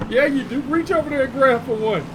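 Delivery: high, mocking mother's insistence; insisting mom. Mom insisting her son grab a cigarette, high mocking voice. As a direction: push him to grab one.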